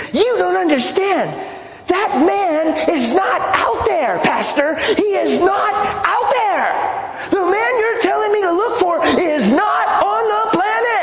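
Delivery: high-pitched, drawn-out imitation of screaming. Freaking-out girl screams at her pastor across the table, imitated shrieking. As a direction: scream it slowly, voice pushed way up like a frantic girl. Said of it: "screaming at me".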